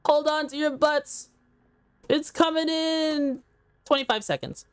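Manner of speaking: high, slow, stretched-out countdown call; slow sustained heads-up to the viewers; drawn-out mock warning. Mock announcing incoming ad break.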